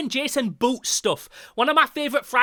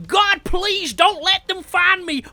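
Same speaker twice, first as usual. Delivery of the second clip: hammed-up screaming for your life; screaming panicked plea; 10 semitones higher, 6 dB louder than usual. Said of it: "running through the woods screaming".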